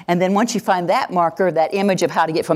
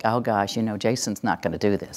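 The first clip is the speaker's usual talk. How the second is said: lower, softer, worried aside; mock dread; 5 semitones lower, 6 dB quieter than usual. Teacher privately doubting a difficult pupil will comply, mock dread. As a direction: grumble it softly, expecting failure. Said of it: "I approach it like".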